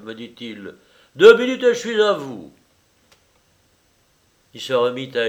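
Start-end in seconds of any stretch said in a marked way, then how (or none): 1.1-2.5 loud, high and quick; breezy, big-voiced promise; hearty host. A narrator's reading. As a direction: call it out briskly while busy. Lyra busy, calls to visitor.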